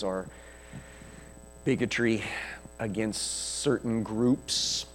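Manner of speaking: measured, subdued closing prayer; leading prayer. Real, not acted. Preacher closing the sermon in prayer to Father, hushed and unhurried. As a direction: pray it gently, with long pauses between phrases.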